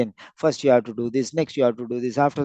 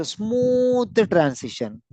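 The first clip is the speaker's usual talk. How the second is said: "smooth" stretched long and raised high; drawn-out emphasis; 6 semitones higher, much slower than usual. Shown as a demonstration. Teacher summing up moving between topics, SMOOTH drawn out. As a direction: glide slowly through a long "smooth".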